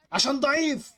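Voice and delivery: high-pitched, exasperated repeat; frustrated outburst. Real, not acted. Gamer blaming his weak line-up, frustrated outburst. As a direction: burst out with it, voice jumping up.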